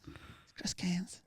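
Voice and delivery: hushed, slow whisper; excited fan whispering. Retold or imitated. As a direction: breathe it like a secret. Fan whispering behind him.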